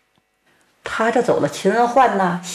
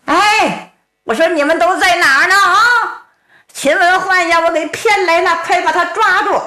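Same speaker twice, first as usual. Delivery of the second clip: high calling voice, much higher throughout; 8 semitones higher than usual. Acted. Tang Tieniu calls to hidden soldiers to seize Qin, high call. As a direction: call into the woods for your hidden men, fast at first.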